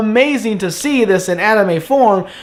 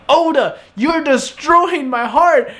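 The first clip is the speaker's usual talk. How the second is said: high, drawn-out playful lament; emotional fan plea, pitched up; overwhelmed fan; 6 semitones higher, slower than usual. Acted. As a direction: cry out to the author in mock heartbreak.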